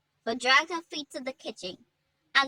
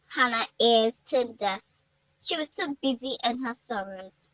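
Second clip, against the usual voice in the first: ordinary delivery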